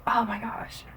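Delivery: soft, whispered re-enacted amazement; awed whisper. Seeing my dad for the first time, awed whisper. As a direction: whisper it as if overwhelmed.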